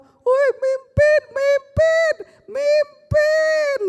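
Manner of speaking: high, loud teasing shout, slow and drawn out; mock him with a laughing cry; laughing mockery. Big brother laughing at the boy's Surabaya accent, teasing shout. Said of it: "kokonya itu langsung ketawa".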